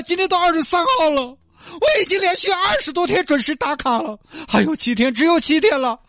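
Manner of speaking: high weepy woman's voice; tearful pleading. Acted. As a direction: beg the driver, half crying over the bonus. Li pleading, near tears.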